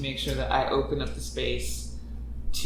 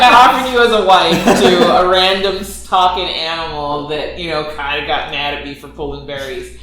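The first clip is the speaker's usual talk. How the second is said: mock motherly confession; 2 semitones higher, 12 dB louder than usual. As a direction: admit something absurd, half laughing. Playing mother confessing, amused.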